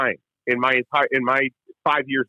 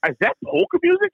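incredulous. Retold or imitated. Disbelief at polka on the radio.

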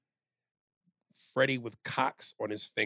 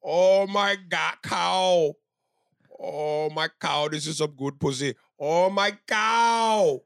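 long drawn-out words, swelling louder and higher; hammed-up cries of pleasure, stretched out slowly; mock ecstatic moaning; 4 semitones higher, 4 dB louder, slower than usual. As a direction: moan it slowly, building to a cry. Comic acted moaning, building to moo.

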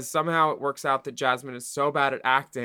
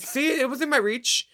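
high-pitched put-on voice; mock-proud, high imitation; imitating a contestant; 10 semitones higher than usual. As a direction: play her triumphant reply up high. Acts out Jasmine's line, high.

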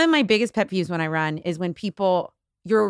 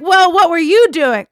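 mocking dog defender; 8 semitones higher, 8 dB louder than usual.

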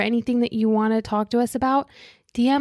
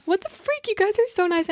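squealing high, amazed and touched; gushing surprise; 10 semitones higher than usual. Retold or imitated. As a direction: gush like you can't believe how sweet they are. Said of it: "I'm like".

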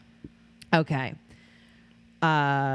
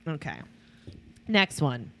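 ordinary talk

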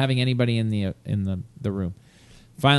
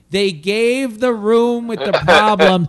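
higher and louder, indignant and fired up; outraged rant; 8 semitones higher, 5 dB louder than usual. Real, not acted. Angry about friends getting his old room, loud indignant rant. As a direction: vent the injustice at full steam. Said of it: "I was so fucking pissed".

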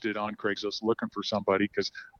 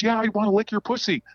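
higher-pitched imitated catcall; retold call-out, pitched up; mock yell; 10 semitones higher than usual. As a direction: lightly imitate a man calling down. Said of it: "he yells down at me".

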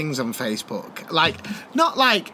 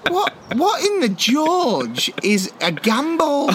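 slow, higher baffled question; 7 semitones higher, slower than usual. Retold or imitated. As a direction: ask slowly in total bafflement. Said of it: "I went".

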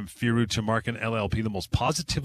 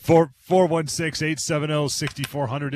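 reading out phone number; 5 semitones higher, 5 dB louder than usual. Acted.

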